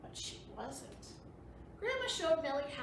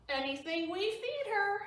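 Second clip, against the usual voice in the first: punchline; 8 semitones higher, 4 dB louder than usual. Acted. Joke punchline voiced.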